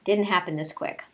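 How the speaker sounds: normal talking voice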